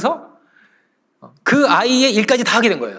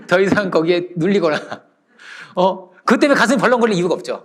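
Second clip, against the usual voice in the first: usual speaking voice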